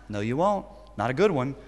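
ordinary delivery

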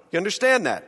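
usual speaking voice

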